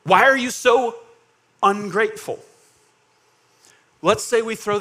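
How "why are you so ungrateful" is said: loud, raised accusation; accusing. Acted. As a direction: confront them, hitting each phrase hard. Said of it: "let's make the accusation".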